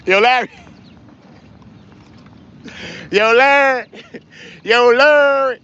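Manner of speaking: high, loud "Larry" with the ending swallowed; drawn-out LARRY. Shown as a demonstration. Streamer showing how to say a viewer's name, drawn-out high holler. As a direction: holler "Larry" as one long slurred syllable. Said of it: "You gotta say it like that".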